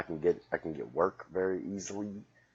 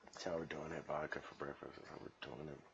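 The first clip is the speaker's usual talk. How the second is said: hushed mutter; 3 semitones lower, 17 dB quieter, faster than usual. Real, not acted. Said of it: "after drinking, hushed".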